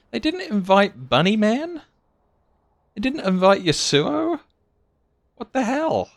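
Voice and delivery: high-pitched, incredulous questioning; indignant disbelief. Real, not acted. Player reacting to friends left off guest list, indignant disbelief. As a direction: protest in outraged surprise, pitch shooting up.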